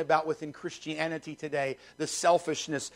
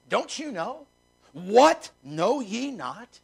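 high questions, slowing into the verse; incredulous reading; 4 semitones higher, much slower than usual. Acted. Preacher reading Paul's question to the church, incredulous. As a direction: read scripture as an astonished challenge. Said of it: "That's what this what is".

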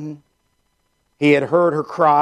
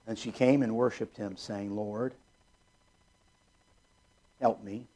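quiet humble reading; 4 semitones lower, 12 dB quieter than usual. Acted. Reading the woman's humble plea.

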